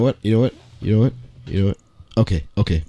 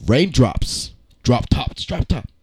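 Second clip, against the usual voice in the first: dramatic reading; 5 semitones lower, 6 dB quieter than usual. Shown as a demonstration. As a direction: read rap lyrics like a poem on stage. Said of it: "we are gonna have a dramatic reading".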